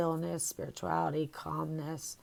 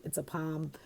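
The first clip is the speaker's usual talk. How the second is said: normal talking voice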